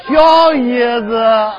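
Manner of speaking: slow, laughing 小姨子; drawn-out punchline. Pretend. Answering the straight man, slow laughing punchline. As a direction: drag 小姨子 out grinning.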